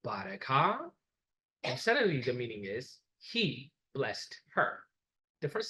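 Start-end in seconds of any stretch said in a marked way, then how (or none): 0.0-0.8 "bareka" with a low tone. Shown as a demonstration. Teacher contrasting tones on one Ge'ez word. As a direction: model "bareka" with the tone held low. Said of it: "with a low tone".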